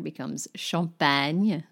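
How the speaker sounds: champagne said as French shahm-pan; French pronunciation. Shown as a demonstration. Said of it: "Champagne, a French word".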